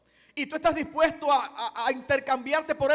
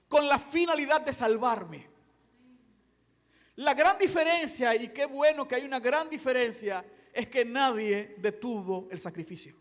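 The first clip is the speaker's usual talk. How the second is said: ordinary talk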